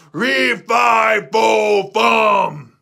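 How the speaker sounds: slow, loud giant bellow; giant roar. Acted. Giant roaring as the harp wakes him. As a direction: drag each syllable out like a waking giant.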